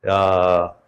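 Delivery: ordinary delivery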